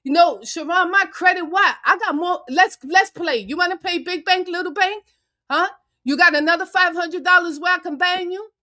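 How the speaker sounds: loud, high-pitched taunting brag; angry bragging showdown; heated taunting. Real, not acted. Heated boasting at a rival.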